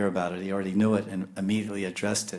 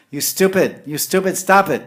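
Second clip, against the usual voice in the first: much higher, loud playful yell; mock shout; 9 semitones higher, 9 dB louder than usual. Scolding someone as stupid, loud playful mock shout. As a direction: shout at a fool in jest. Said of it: "a criticism, you calling someone stupid".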